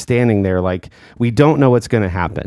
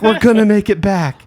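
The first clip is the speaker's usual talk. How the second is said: excited passengers; 10 semitones higher than usual. Retold or imitated. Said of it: "but were like".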